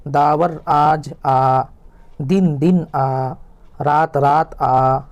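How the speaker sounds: slow, evenly spaced primer reading; slow reading drill. Shown as a demonstration. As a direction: read each word apart like a class drill. Reads the drill lines slowly. (قاعدہ آہستہ آہستہ پڑھ کر سنانا)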